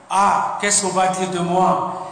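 ordinary talk

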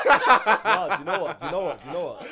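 loud laughing while talking; cracking up mid-sentence. Real, not acted. Laughing loudly, repeating.

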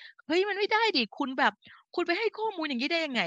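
high-pitched indignant objection, acted out; indignant protest. Health coach voicing her reaction to wrong information, indignant. Acting out own objection to misinformation.

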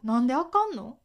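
Kansai intonation on なんで; 関西弁. Shown as a demonstration. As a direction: ask なんであかんの with a Kansai lilt. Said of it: "なんでのイントネーションも". (関西弁の抑揚で「なんであかんの」)

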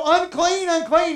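high, loud leper's warning; crying out. Acted. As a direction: call out loudly to warn people away. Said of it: "they're supposed to cry out".

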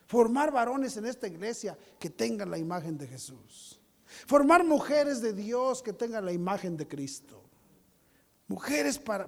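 ordinary talk

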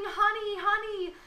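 high shouted calls across the harbor; screaming. Acted. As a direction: scream her pet name from far away. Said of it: "and you're screaming".

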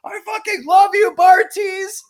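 high, loud fanboy shout; re-enacted concert scream of adoration; fan's yell. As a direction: yell it to the stage over the crowd. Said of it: "at one point I yelled".

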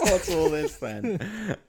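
slow, drawn-out bobby voice; old copper voice. Pretend. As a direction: stretch it like a stage copper. Policeman line repeated slowly.

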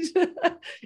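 short high burst of laughter. Real, not acted. To the host about clients, a high laugh. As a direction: let out a quick laugh.

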